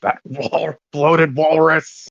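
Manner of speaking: slow, drawn-out villain sneer; villain insult. Acted. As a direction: sneer it slowly like a cartoon villain.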